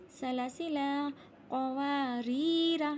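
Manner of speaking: slow, measured chant; recitation demo. Shown as a demonstration. Teacher showing where alif is silent, slow recitation. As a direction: draw out the long vowels. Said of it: "यहाँ भी अलिफ को नहीं पढ़ेंगे".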